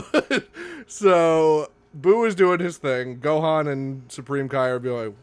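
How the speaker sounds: ordinary delivery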